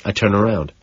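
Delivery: ordinary delivery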